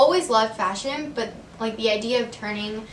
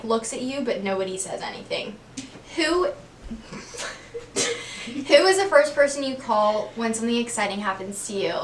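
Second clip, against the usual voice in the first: ordinary talk, nothing marked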